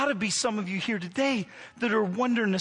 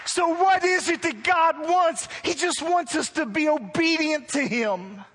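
loud, higher, driving sermon voice; preaching at full force; 8 semitones higher, 3 dB louder than usual. Acted. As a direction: press the point home to the church.

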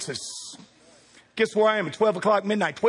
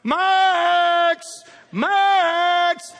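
high, loud repeated call; calling a lost dog at night; 10 semitones higher, 5 dB louder than usual. Retold or imitated. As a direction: shout the dog's name across the field. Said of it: "walking around ... going".

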